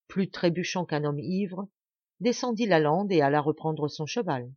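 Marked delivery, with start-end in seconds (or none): none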